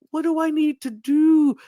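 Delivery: high mock plea, drawn on "do"; pleading. Chandler begging the judges for guidance, imitated pleading. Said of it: "where Chandler was pleading".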